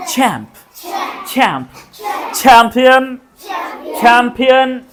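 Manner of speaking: crisp repeated model words, breathy CH; aspirated English CH. Shown as a demonstration. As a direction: drill चैंप with छ at the start. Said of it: "च नहीं, छ".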